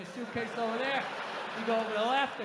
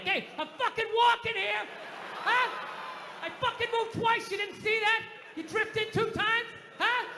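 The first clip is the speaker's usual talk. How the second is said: put-on old-school Dustin Hoffman, De Niro; 11 semitones higher than usual. Confronting a stranger on the street, outraged movie-tough-guy shout. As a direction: bark indignantly at whoever bumped you. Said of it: "old school Dustin Hoffman, De Niro, like".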